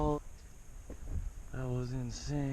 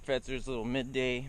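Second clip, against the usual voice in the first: usual speaking voice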